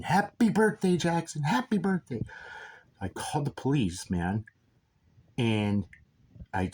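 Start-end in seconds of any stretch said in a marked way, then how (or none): 0.0-2.2 very high mock holler; high-pitched imitated yelling; retold taunting yell. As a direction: imitate the shouter, pitched far above your own voice. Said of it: "And he was saying".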